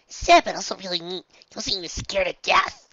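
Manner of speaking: put-on loud, high gushing girl; high excited gush. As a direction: gush it eagerly. Nora character voice.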